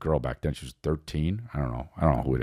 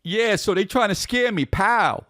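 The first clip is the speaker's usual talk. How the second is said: much higher, punchy comic voice; 13 semitones higher, slower than usual. Retold or imitated. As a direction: read it like a comic panel. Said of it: "Like it's a comic book".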